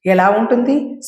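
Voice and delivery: ordinary delivery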